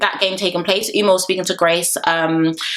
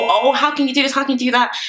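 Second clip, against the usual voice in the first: mock woe-is-me protest, lightly pitched up; mildly put-on poor-me indignation; 5 semitones higher than usual. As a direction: imitate a wronged victim's complaint. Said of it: "you were doing up woe is me".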